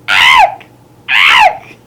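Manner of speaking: very high, loud bird shriek; screaming jays. A narrator's reading. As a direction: screech like an angry jay. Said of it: "The jays screamed".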